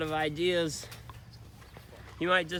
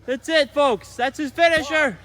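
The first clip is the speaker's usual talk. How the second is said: higher, louder hype call; excited call; 7 semitones higher, 9 dB louder than usual. Acted. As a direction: call the finish like a commentator. Commentator calling the finish.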